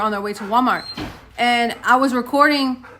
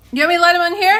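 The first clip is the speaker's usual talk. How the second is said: raised, higher-pitched question to someone off; real called-out question, louder and higher; calling out; 8 semitones higher, 7 dB louder, much faster than usual. Real, not acted. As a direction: call it across the house, pitch lifting at the end.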